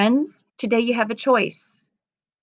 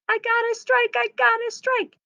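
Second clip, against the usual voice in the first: high, thrilled "I got a strike!"; excited cheer. Acted. Girl in the story cheering her strike, excited high cheer. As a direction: cheer it like you just bowled a strike.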